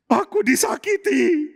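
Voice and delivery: put-on high, slow, small girl's voice; hurt girl Tamar. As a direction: confess the hurt slowly, like a young girl. Tamar confesses being hurt. (suara gadis terluka, tinggi dan pelan)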